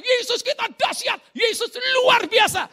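high, loud shout of praise; excited yelling. Retold or imitated. Friend keeps praising Jesus despite being shushed, high excited yelling. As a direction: yell it out jubilantly, imitating the friend. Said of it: "dia malah teriak-teriak".

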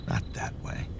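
breathy whisper, no voice. A narrator's reading. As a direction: whisper the order softly. Repeats the command softly.

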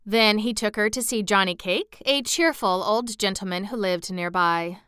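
plain reading voice

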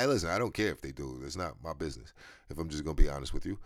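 usual speaking voice

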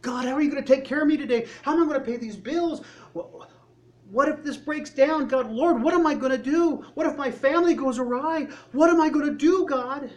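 high, loud, fast, panicky questions; anxious worrying. Retold or imitated. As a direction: fret out loud about the bills. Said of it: "don't we fall in that same trap?".

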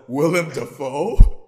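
slow, loud, laughing disbelief; incredulous. Retold or imitated. Reacting to the wig, laughing disbelief. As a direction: say the name in mock shock. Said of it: "I was like".

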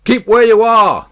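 high, loud shouted order; guard's bellowed warning; called command. A narrator's reading. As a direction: halt a stranger at a distance. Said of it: "the guard called to the voice".

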